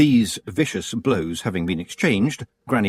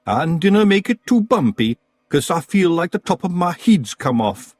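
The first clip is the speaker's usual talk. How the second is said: loud, high Scottish pixie; cheeky wee Scot shouting up; Scottish Feegle; 9 semitones higher, 5 dB louder than usual. Acted.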